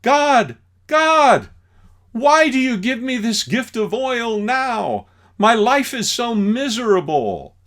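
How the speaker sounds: loud, high wail to the heavens; hammed-up complaint to God; mock lament. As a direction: plead with God in mock despair.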